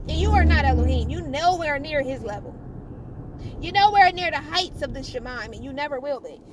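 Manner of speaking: loud, fast scolding of the devil; loud denunciation. Real, not acted. Preacher scolds the devil before the crowd, loud and fast. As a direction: tell the devil off with force.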